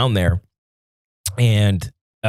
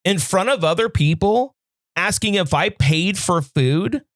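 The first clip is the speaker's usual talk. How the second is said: higher, heated retelling of going off; retold rant; 8 semitones higher than usual. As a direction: replay the angry rant at the manager. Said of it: "I went fucking crazy".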